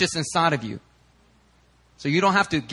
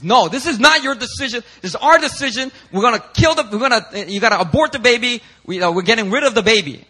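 higher, louder bossy demand; mock angry boyfriend; 7 semitones higher, 6 dB louder than usual. Preacher acting the pushy boyfriend in the hidden-camera skit, hammed-up anger. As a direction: lay down the law to her like a pushy boyfriend. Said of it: "And says".

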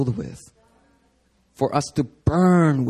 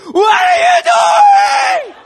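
much higher, louder scream; 9 dB louder than usual. Retold or imitated. Jesus screaming at the money changers. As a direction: scream it at them full force. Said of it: "but he's screaming".

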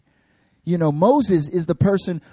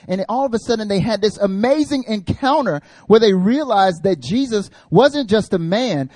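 ordinary talk

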